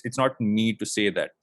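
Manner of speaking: normal talking voice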